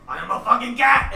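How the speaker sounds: loud, high cat outburst; indignant cat. Acted. Cat snaps at the druid before clawing, loud indignation. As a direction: burst out loudly as an offended cat. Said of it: "Mister just goes".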